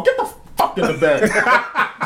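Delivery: slow, high, laughing command; drawn-out mock order. Friend acting out ordering the girlfriend into the back, slow and laughing. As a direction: stretch every word like a fed-up order.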